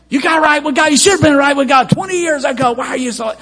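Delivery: loud, high scolding of a latecomer; angry preacher in the lobby. Retold or imitated. Preacher in the lobby scolding a Mother's Day visitor, loud and high. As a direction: snap at a churchgoer who only comes on Mother's Day. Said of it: "a preacher can't be angry all the time".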